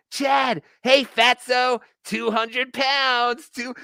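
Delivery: high-pitched mock taunt; Ray. Host mimicking a comedian taunting his rival, high jeering. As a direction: heckle in a high, drawn-out jeer. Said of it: "Ray was going".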